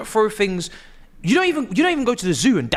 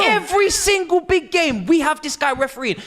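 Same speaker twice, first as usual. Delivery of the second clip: angry rant; 8 semitones higher than usual. Real, not acted. Ranting about the referee.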